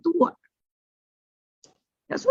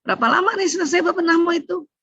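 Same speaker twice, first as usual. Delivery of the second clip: much higher, probing question; lightly acted prying mother-in-law; mother-in-law's questioning; 9 semitones higher, faster than usual. Mother-in-law checking on the tidying, high-pitched questioning. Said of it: "Ibu mertua nanya nanti".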